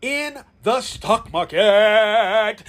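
slow, stretched out stock market; drawn out. Acted. Pitching listeners on investing, slow stretched phrase. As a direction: stretch each word like a hook.

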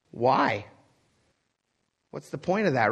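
ordinary talk

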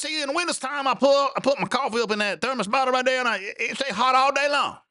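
hammed-up yokel explaining earnestly; high put-on country voice; old country boy.

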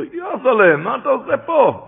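high-pitched astonished question; surprised questioning. Acted. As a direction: act out an uncle startled to find his nephew there. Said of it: "שאל אותו".

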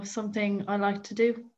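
ordinary talk, nothing marked

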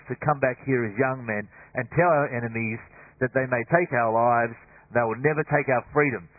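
rally frightened troops, drawing out the first commands; William Wallace. Acted. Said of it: "to deliver that speech".

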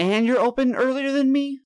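high, loud indignant whine; mock outrage. Rival snake guy outraged at the competition, high and loud. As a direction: sound betrayed and outraged.

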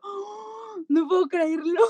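la voz se le quiebra de emoción; emoción real con llanto; conmovida hasta llorar. Real, not acted. Reacción emocionada al oír hablar al niño. (se le quiebra la voz de emoción al recordarlo)

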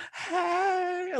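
very high, soft drawn-out greeting; mock girly greeting. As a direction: imitate a commercial model cooing hello. Impersonates contestant's greeting in commercial.

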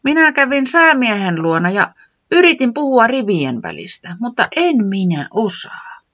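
high, whiny little voice; Tiputi complaining. Acted. Tiputi complaining to Pöllis, high and whiny. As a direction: complain with a high fall at the end. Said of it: "Tiputi valitti".

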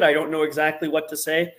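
usual speaking voice

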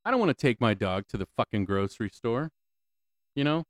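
ordinary delivery

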